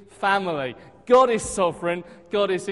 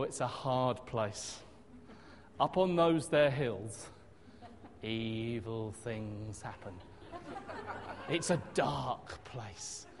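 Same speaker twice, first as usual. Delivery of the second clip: much lower, softer, slow ominous warning; gloomy doom voice; 8 semitones lower, 7 dB quieter, slower than usual. Retold or imitated. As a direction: intone it like a spooky prophecy, slow and low. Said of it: "some people said".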